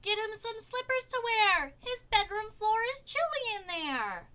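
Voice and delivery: high, loud yell; a child's voice hollering across a crowd; girl yelling. A narrator's reading. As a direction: yell it out on one high pitch. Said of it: "A girl yelled".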